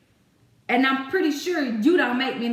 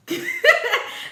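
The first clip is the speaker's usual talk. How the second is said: burst of laughter; 5 dB louder than usual. Real, not acted. Laughing at own rant.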